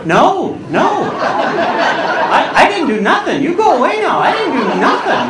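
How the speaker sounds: high, swooping mock-frightened refusal; panicked protest. Passenger resisting a flight attendant's summons, hammed-up panicked protest. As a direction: plead innocence in an exaggerated panic.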